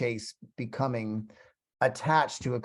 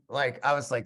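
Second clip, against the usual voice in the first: usual speaking voice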